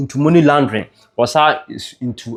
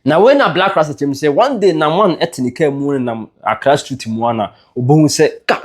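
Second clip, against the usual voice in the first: plain reading voice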